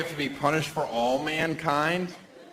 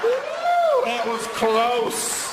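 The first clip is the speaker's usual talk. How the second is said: much higher, loud whoop then slow; relief whoop; 4 dB louder, much slower than usual. Retold or imitated. Comic playing a relieved guy, loud whoop. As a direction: whoop with relief at a near miss.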